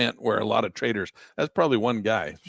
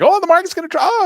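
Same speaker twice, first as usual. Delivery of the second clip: squealing high and loud with fake excitement; mock excited trader; 10 dB louder than usual. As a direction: imitate an overeager trader, way up high. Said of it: "you get all excited and you're like".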